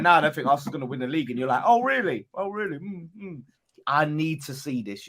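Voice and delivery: normal talking voice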